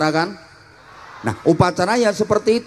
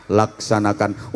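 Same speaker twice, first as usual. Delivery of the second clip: relaxed inspector reply; 8 semitones lower, 3 dB quieter than usual. Shown as a demonstration. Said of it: "nyantai jawabnya".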